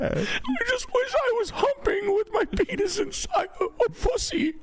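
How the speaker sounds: very high, slow falsetto whine; high whiny voice. Pretend.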